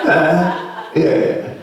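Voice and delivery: ordinary delivery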